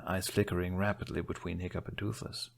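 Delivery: usual speaking voice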